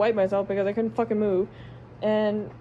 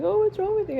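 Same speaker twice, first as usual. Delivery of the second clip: mocking the family; 9 semitones higher than usual. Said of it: "they're just like".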